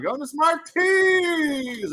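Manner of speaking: high, slow announcer shout; shouted prize call. Pretend. Streamer announcing the winner with a shout. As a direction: belt the winner's name.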